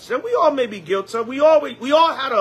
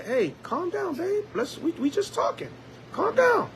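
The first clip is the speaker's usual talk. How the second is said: high, softer, soothing plea; soothing wife; 10 semitones higher, 6 dB quieter than usual. Retold or imitated. Wife calming her yelling husband, higher and softer. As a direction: gently talk your husband down. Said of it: "the wife say".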